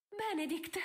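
high, ringing call of his name; joyful exclamation. Acted. Sophie calls to Benedict through the bars, high and excited. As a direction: call out to him in relief. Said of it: "esclamò Sophie".

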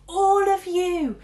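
slow, high, loud call; shouting. A narrator's reading. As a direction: yell it up the building. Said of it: "shouted Zoe".

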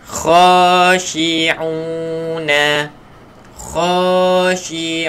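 Khashi'una slow with a thick, throaty kha; teacher modelling a thick kha; heavy kha. Shown as a demonstration.

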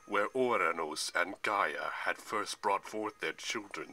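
slow, grand Titan voice; lecturing Atlas. A narrator's reading. Atlas explains to Percy where sky met earth, lecturing. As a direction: proclaim it with ponderous weight.

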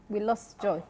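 normal talking voice

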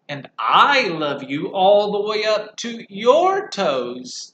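very slow and loud; playful big hare topping him; big hare swinging the little one. Acted.

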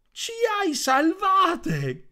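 very high, loud, very slow cry of thanks; grateful shout. Acted. The three frogs thank the toad together, high slow joyful shout. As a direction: draw out the relief. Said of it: "gridarono tutte insieme".

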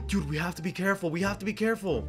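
hushed, quick warning; hushed caution. Pretend. Gamer warning friends while sneaking, hushed caution. Sneaking near the bully's house.

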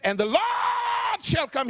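high, slow shouted call; shouted church response. Retold or imitated. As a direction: cry it out across the pews. Woman shouts out in quiet church.

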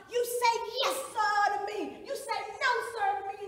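high, loud bullying command; domineering demand. Acted. Jailers beating a woman until she says sir, shouted demand. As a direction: hammer out the order, demanding submission.

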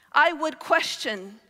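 usual speaking voice

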